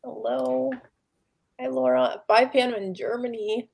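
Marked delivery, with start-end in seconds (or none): none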